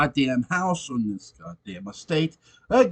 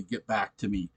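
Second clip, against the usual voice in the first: quiet literal quote; 7 semitones lower, 9 dB quieter than usual. Shown as a demonstration. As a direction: lower and much softer, held back.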